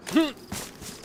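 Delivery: quick high giggle; a burst of amused laughter; high short laugh. Real, not acted. Laughing at boats on land.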